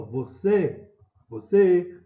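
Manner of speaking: CÊ loud and held in Você; calling out. Shown as a demonstration. Teacher demonstrating a call, stressed CÊ in Você. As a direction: call "Você" out, punching CÊ harder the second time. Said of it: "Chamar o você".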